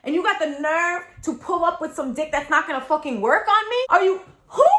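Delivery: high-pitched, disbelieving protest; incredulous outrage. Retold or imitated. Woman reacting to partner's failure in bed, incredulous outrage. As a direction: act out being indignant and incredulous.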